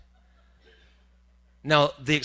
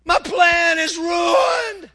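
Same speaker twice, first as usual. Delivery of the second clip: high, slow squealing shout of panic; shrieking hammed-up dismay; mock panicked God; 16 dB louder, much slower than usual. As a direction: ham up a God in a flap.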